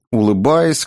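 ordinary delivery

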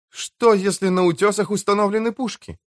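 high-pitched, anxious wondering; worried thought. Acted. Tom eyes the cliffs for guns, worrying. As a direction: pitch it up as a nervous question.

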